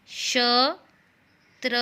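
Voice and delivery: ordinary talk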